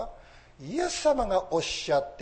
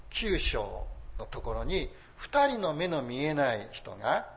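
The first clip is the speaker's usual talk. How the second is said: ordinary talk, nothing marked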